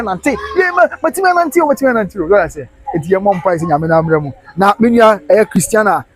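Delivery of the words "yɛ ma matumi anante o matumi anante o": sudden high burst of joy; joyful exclaim. Acted. Healed beggar shouting that he can walk. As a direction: cry out that you can walk.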